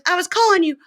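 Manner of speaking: high-pitched hammed-up complaint; exasperated scolding. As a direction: hammer the repeats like a fed-up girlfriend. Girlfriend scolds him in retelling.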